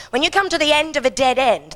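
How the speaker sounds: ordinary talk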